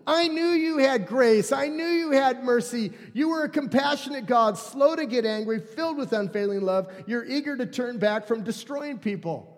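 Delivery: high, loud, exasperated whine; complaining Jonah. Retold or imitated. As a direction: vent frustration at God. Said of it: "he complained to the Lord".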